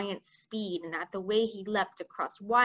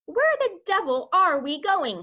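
raised, louder demand in storytelling; mildly frantic; 8 semitones higher, 7 dB louder than usual. A narrator's reading. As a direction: read it as a flustered commander losing his bearings.

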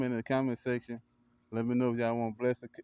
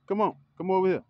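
louder, higher call; 8 semitones higher, 6 dB louder than usual. Real, not acted. Calling the kid over.

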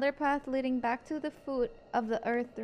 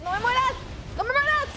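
panicked plea; 9 semitones higher than usual. Real, not acted. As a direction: cry out in playful panic. Panic that the creature dies. (súplica aguda de pánico)

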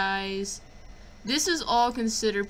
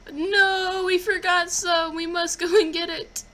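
alarmed; 9 semitones higher, 5 dB louder, slower than usual. Real, not acted. Realizes items left behind.

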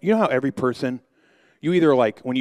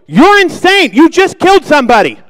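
panicked yell; 14 dB louder than usual. Retold or imitated. Panicked after friend's throat punch.

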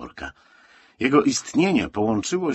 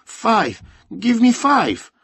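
put-on very high, loud child's demand; high-pitched, pushy young haggler; insistent boy; 9 dB louder, much slower than usual. A narrator's reading. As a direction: call out the price urgently. Boy haggling urgently for money.

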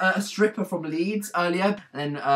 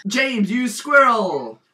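higher, louder, drawn-out cry; loud mock insult; 5 semitones higher, 5 dB louder, much slower than usual. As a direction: call it out slowly with big emphasis. Repeats silly insult more forcefully.

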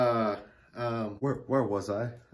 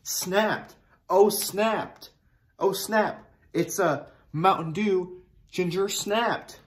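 higher, punning "snapped" with glee; playful excitement; 8 semitones higher, 3 dB louder, much slower than usual. Acted. Host punning on the soda name, higher and excited. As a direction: toss off "Oh, snap" like a catchphrase, up high.

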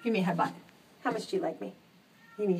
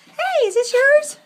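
excited pet talk; 11 dB louder, slower than usual. Real, not acted. Finds something of the cat's.

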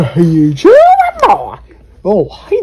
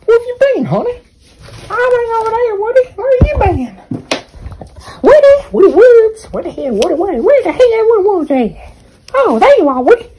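put-on high-pitched doting girlfriend voice; squeaky sweet-talking partner; 12 semitones higher than usual. Girlfriend character calls for Woody.

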